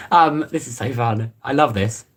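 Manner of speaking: low, flat sarcastic delight; mock cheer in a low voice; deadpan put-on voice. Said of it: "Why do I speak like that?".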